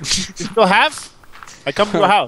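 lightly hammed-up Hawaiian; mock Hawaiian accent. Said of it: "Now I said more Hawaiian".

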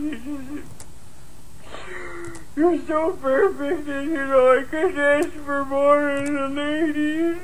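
put-on high, blubbering drunken sobs; weepy. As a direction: choke up while gushing.

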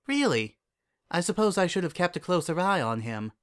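high-pitched princess voice; curious royal mentor; Celestia, interested mentor. Acted. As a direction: sound interested, with lifted pitch.

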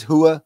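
"Hua" said without the r, like hua. Retold or imitated. Telling friends how Joey said it, r dropped from "Hua". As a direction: drop the r in "Hua". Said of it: "he says".

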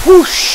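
loud high whoosh of spraying water; whoosh sound. A narrator's reading. Narrator voicing the squirt gun firing, loud whoosh. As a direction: blast it out like a water jet.

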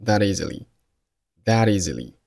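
"that easily" run together with no pause; "that easily" as one joined word; linked reading. Shown as a demonstration. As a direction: slide the end of "that" straight into "easily". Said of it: "发生了辅元连读".

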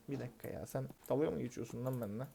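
soft disbelieving mutter, "dalga" barely voiced; quiet mutter. Real, not acted. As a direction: grumble "sen dalga mı geçiyorsun lan benimle" quietly to himself. Customer mocking him, muttered then said aloud.

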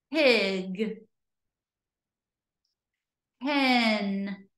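usual speaking voice

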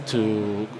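usual speaking voice

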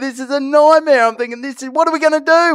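high-pitched mock alarm; retold panic. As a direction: act out fretting in a stuck lift. Retells being stuck in lift.

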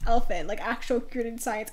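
usual speaking voice

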